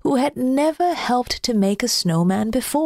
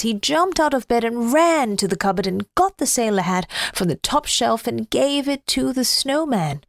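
ordinary delivery, nothing marked

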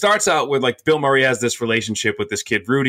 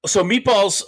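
a sudden squeaky crack; 9 semitones higher, slower than usual. Real, not acted. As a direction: voice cracks up high on Meatballs. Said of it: "That my voice just cracked".

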